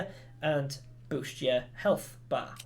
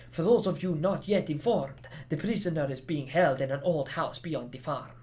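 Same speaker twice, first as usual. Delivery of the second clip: badly faked Spanish accent; attempted Spanish accent. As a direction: read it in an amateur's Spanish accent. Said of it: "I should be using a Spanish accent".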